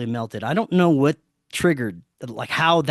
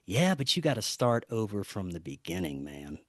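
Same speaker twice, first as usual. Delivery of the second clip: low, soft imitation sinking at the end; hushed, fateful retold reply; ominous calm; 6 semitones lower, 9 dB quieter than usual. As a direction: quote him quietly, dropping away on the last word. Eerie friend's cryptic reply.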